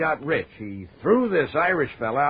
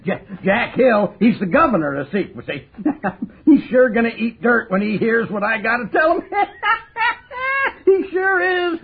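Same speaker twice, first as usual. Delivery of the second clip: cackling with glee, words bursting through laughter; loud gloating laughter breaking into the words; gleeful laughing; 9 semitones higher, 4 dB louder than usual. Acted. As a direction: laugh in triumph at the news. Gloating over catching the convict.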